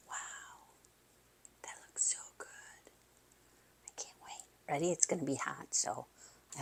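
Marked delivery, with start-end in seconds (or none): 0.1-0.7 soft excited whisper. Real, not acted. Whispers over the food. 1.6-2.9 hushed whisper of delight. Real, not acted. Whispers over the food. 3.9-4.4 soft excited whisper. Real, not acted. Whispers over the food.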